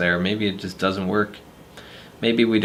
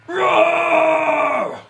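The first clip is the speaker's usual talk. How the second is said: screamed roar, much higher and louder; monster roar; 5 dB louder than usual. Acted. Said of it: "You gotta feel it".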